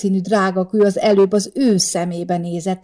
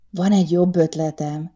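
ordinary delivery

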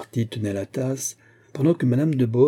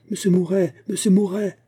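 pressing call; 9 semitones higher than usual. A narrator's reading. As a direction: call him insistently, voice raised high. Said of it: "un appel pressant de ces dames".

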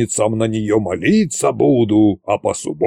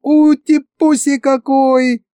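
very high, slow gushing coo; baby-talk cooing; 3 dB louder, slower than usual. A narrator's reading. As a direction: coo as if over a tiny puppy. Masha coos over shrunken dragon.

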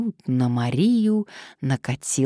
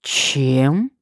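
louder, drawn-out disbelieving "what"; incredulous question; 5 dB louder than usual. A narrator's reading. Blackmailer asking what she would shred him with, incredulous. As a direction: ask back in disbelief. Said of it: "недоверчиво переспросил шантажист".

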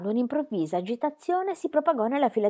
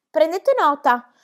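slow, high, eager echo; encouraging echo; 8 semitones higher, 8 dB louder, slower than usual. A narrator's reading. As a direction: urge the secretaries on, drawn out. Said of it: "le incoraggiò".

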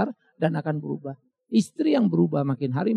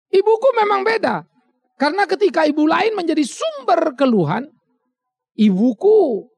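high, loud burst of pride; proud exclamation; 8 semitones higher, 5 dB louder than usual. Acted. Preacher voicing a proud child, high and loud. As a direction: lift it up with pride.

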